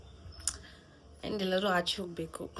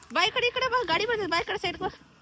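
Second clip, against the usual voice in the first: high urgent call across the street; 14 semitones higher than usual. Real, not acted. Calling a little boy out of the road, urgent call. Calling child away from traffic.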